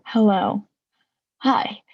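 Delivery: ordinary talk, nothing marked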